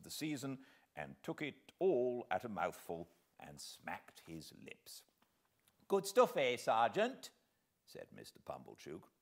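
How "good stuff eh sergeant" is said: much higher, louder jolly question; a pompous host fishing for praise; jovial host. Acted. Pumblechook offers wine.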